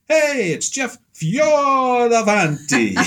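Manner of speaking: high, loud, slow announcer-style introduction; grand intro. Retold or imitated. Frank announcing him at the pastel society, big slow announcer voice. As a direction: stretch the name out like a ring announcer. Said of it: "Nice intro there".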